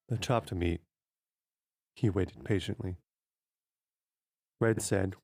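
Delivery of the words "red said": usual speaking voice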